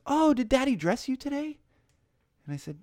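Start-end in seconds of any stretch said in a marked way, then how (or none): 0.1-1.6 very high, mock baby-talk question; cooing older cashier. Checker asking a little girl about Daddy, patronizingly sweet. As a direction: imitate a gushing lady talking down to a toddler. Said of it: "and she says".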